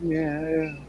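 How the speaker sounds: soft, bored, barely-there yeah yeah; disinterested murmur. Retold or imitated. Girlfriend dismissing the artist's prints, bored and soft. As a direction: mumble it like it's not worth a look. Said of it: "She looked at my stuff and was like".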